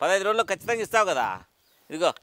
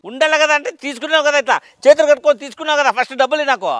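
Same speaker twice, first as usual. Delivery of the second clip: higher and much louder, shouting him down; furious shout; 5 semitones higher, 8 dB louder than usual. Acted. Lender shouts down the debtor who denies owing, furious. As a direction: yell the accusation, hammering each కదా. Quarrel over unpaid loan.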